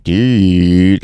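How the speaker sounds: "Dude" with an exaggerated, stretched U; overdone fronted U in "Dude"; extra-long U. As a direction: drag out the U in "Dude," Baltimore style. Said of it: "like the U is just so extra".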